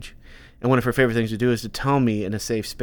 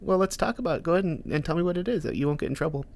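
high, soft reassuring parent voice; dad's kid-talk voice; 5 semitones higher, 6 dB quieter than usual. Retold or imitated. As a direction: coax a small child gently, pitched up. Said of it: "I have, like, this octave that I go up".

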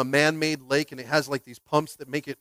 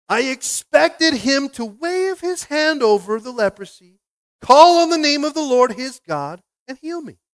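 much higher, louder, slow complaint; indignant outcry; 10 semitones higher, 8 dB louder, slower than usual. Acted. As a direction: build to an offended outcry. Naaman's complaint acted.

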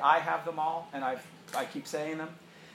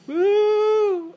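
excited whoop; 11 dB louder than usual. Real, not acted.

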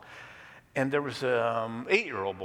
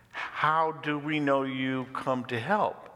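slow, slightly raised questioning; wary questioning; 5 semitones higher, 5 dB louder than usual. Acted. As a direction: question a stranger's promise slowly. Wary cave people question visitor.

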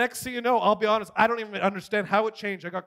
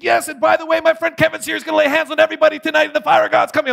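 high, loud rally announcement; imitated excited shouting from the stage; hyped-up host; 8 semitones higher, 7 dB louder, faster than usual. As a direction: hype the guest to a roaring crowd. Imitates host announcing him to crowd.

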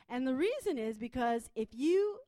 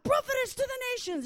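put-on high, loud French-accented holler; full-force mock French-accented shout; 13 semitones higher, 9 dB louder than usual. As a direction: imitate an intense friend shouting in a French accent. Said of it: "she's shouting at me".

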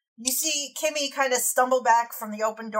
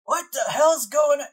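put-on old man voice; 6 semitones higher, 6 dB louder than usual. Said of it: "you hear this old voice".

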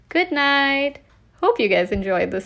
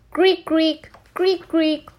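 higher, drawn-out creak noises; creaking floorboard sound; 6 semitones higher, slower than usual. Acted.

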